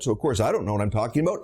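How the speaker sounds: ordinary talk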